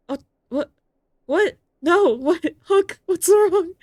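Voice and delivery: high, loud alarmed cries; sudden rising panic; shocked outcry. Acted. Shock at bad news.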